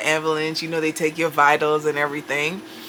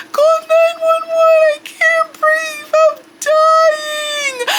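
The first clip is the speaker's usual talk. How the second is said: much higher, louder, sobbing panic; panicked crying plea; 5 dB louder, slower than usual. Retold or imitated. Mother begging her daughter to call for help, crying in panic. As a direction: wail it like you think you're dying. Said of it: "let me speak in my normal voice".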